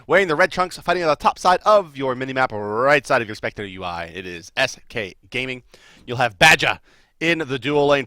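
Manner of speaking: big announcer lineup read; announcer intro. Acted. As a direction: call out the lineup like a ring announcer.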